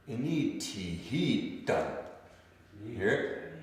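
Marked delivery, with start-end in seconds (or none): 0.1-1.9 slow INI TI HI, then short, quick DA; broken into syllables. Shown as a demonstration. Teacher breaking down my friend, syllable by syllable with short ending. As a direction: stretch Ini ti hi and cut da short. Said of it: "It's short and quick".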